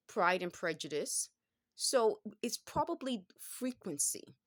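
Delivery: usual speaking voice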